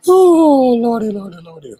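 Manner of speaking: mock exasperation. Real, not acted. Host reacting to a viewer's name, hammed-up lordy. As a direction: throw up your hands at a joke.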